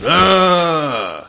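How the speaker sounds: zombie roar. Acted. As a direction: let a zombie roar rip. GM voices the zombie again.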